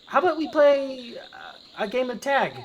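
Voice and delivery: put-on very high kid voice; high, hesitant invitation from a child; child character. Kids warming up to the goblin invite him to play, childlike. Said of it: "And they say".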